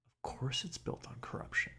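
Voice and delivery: whispered, like a bitter secret; whispered aside. Real, not acted. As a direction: drop to a whisper for the truth. Aside about police corruption.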